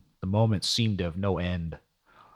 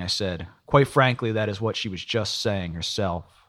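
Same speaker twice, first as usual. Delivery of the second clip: plain reading voice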